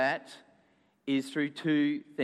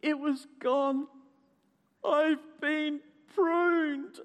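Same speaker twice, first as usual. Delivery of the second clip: high, very slow, drawn-out groan; hammed-up woeful moan; 9 semitones higher, much slower than usual. A narrator's reading. Basil finds his leaf gone, groaning. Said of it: "groaned Basil".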